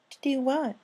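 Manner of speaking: high, quick question; high curious voice. Acted. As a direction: ask it bright and high. Rainbow voiced in story.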